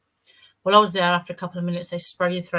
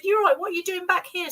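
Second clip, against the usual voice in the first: imitating the doctor, pitched much higher; retold double take, mildly hammed up; surprised greeting; 12 semitones higher than usual. Doctor seeing Tina back early in clinic, imitated surprise.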